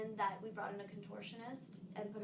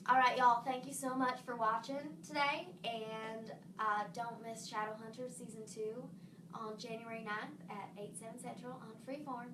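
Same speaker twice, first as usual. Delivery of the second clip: friendly Southern sign-off, starting loud; Southern accent; 5 dB louder than usual. Shown as a demonstration. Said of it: "I'll do Southern again".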